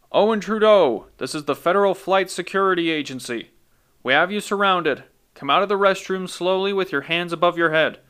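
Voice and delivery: loud, high, booming command; booming megaphone voice. Acted. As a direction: boom it through a megaphone. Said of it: "the voice boomed".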